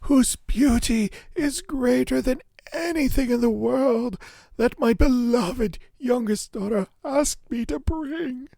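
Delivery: high, loud joyful outburst of wonder; joyful cry. Acted. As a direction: cry out in delight at finding the flower. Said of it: "he cried out joyfully".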